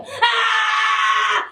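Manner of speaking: high loud scream. Acted. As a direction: let out a shrill cry of fright. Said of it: "Mildred begins to scream".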